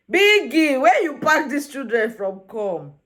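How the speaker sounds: loud, high opening call; exasperated calling out. Pretend. Viewer complaining to Biggie about housemates, loud exasperated call. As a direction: call out in mock despair, drawing it out.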